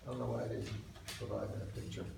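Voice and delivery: ordinary delivery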